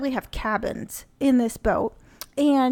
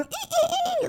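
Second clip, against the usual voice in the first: put-on very high childish squealing; high kid squeals; 3 dB quieter than usual. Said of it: "like one of those kids".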